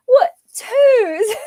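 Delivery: high, rising posh "Toes?"; really posh accent. Retold or imitated. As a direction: mimic his posh, puzzled question in a high voice. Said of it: "saying it with a really posh accent".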